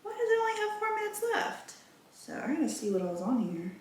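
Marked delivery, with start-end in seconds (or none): 0.0-1.7 high, quick puzzled "huh", softer; mock baffled question, pitched way up; retold surprise. Puzzled at the memory card, a high quick retold surprise. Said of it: "And I said".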